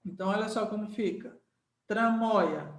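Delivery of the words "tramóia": ordinary talk, nothing marked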